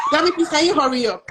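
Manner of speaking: high, loud shout to hurry someone; impatient, half-laughing holler; calling out. Real, not acted. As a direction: yell it to a friend out of the room. Calls offscreen friend to hurry.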